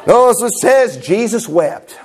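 hammed-up revival reading of scripture; mock camp meeting preacher. Shown as a demonstration. Preacher parodying how revivalists milk one verse, drawn-out mock preaching. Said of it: "I could preach like that all night".

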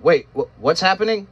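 high, stammering alarmed cry; alarmed yell. A narrator's reading. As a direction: panic as the boulders start falling. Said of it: "I was alarmed and yelled".

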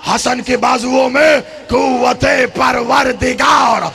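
full-force preaching. Acted. Orator praising Hasan, Husain and Ali, preached at full force.

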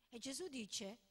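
ordinary talk

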